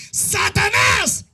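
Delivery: slow, ringing rebuke of Satanás from the pulpit; rebuking shout. Real, not acted. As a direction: stretch the name out in a slow rebuke.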